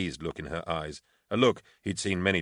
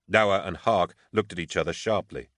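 usual speaking voice

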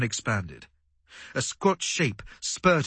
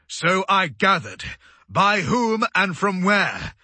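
raised, higher commanding voice; impatient demand; 6 semitones higher, 4 dB louder, slower than usual. A narrator's reading. Commander answering an obvious report, impatient demand. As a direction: demand details, measured and forceful. Commander snaps back at crewman.

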